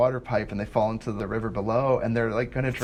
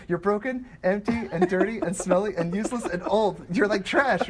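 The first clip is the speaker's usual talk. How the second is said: higher, matter-of-fact corpse listing flaws; blunt Manny voice; 8 semitones higher than usual. Retold or imitated. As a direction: lay out the insults innocently as Manny.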